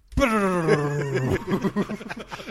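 loud, high rolled "brrr"; long trilled lip noise for a spinning ball; imitated rattling ball. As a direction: mimic the roulette ball rattling, then laugh.

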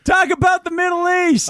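very high, loud heckle; heckler's shout. Acted. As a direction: holler a topic at the stage.

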